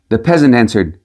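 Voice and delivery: ordinary delivery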